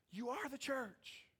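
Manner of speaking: slow, high, drawn-out voice; high-pitched repeat. Real, not acted. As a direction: repeat it slowly up high to drive it home.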